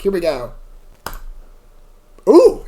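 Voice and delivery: ordinary delivery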